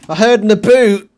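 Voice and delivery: put-on loud teasing Canadian accent, "boot" said as "aboot"; mock Canadian accent. Reactor teasing the podcast host's Canadian accent, loud mock Canadian accent. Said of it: "Is he Canadian?".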